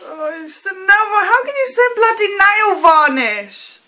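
high, loud, incredulous delight; amazed excitement. Real, not acted. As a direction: exclaim it, astonished at the gift. Amazed at finding nail varnish.

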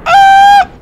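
very high, loud short shriek; sudden squeal of alarm; startled yelp. Real, not acted. Gamer caught by surprise mid-game, high startled yelp. Startled while playing a shooter.

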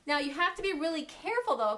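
ordinary delivery

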